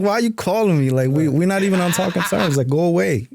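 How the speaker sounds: to his ex-wife, high exasperated mock dismissal; exasperated brush-off. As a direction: shoo her off in disbelief. Retelling his reply to his ex-wife.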